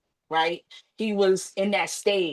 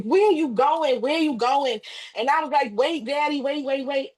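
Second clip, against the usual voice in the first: panicked pleading; 7 semitones higher than usual. Retold or imitated. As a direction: beg him not to go. Pleading with dying father.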